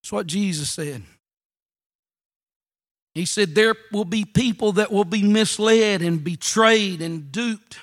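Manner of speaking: low, soft, grave warning; hushed solemn preaching. Real, not acted. Preacher warning church about false prophets, hushed solemn preaching. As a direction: drop right down and let it sink in.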